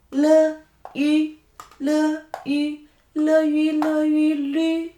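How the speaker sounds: "le, ü" chanted high and level, merging into "lü"; syllable blending drill. Shown as a demonstration. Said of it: "find the partner as".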